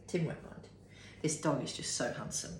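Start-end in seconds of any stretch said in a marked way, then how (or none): 0.1-0.7 whispered aside. Shown as a demonstration. Whispering to the dog that Tim won't mind. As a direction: breathe it with no voice. Same words repeated unvoiced.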